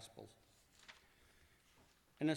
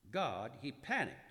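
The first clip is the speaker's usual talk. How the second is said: usual speaking voice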